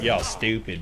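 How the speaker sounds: lazy appalachian drawl on y'all; hillbilly appalachian accent. Pretend. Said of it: "I just said that like a hillbilly".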